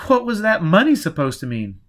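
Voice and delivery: high, loud insistent question; demanding. A narrator's reading. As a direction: press hard for an answer. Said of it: "he demanded".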